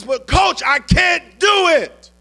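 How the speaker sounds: high, loud, slowed cry of defeat; despairing cry. Retold or imitated. An exhausted player crying out to his coach, high and loud. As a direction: wail it out like he's about to collapse.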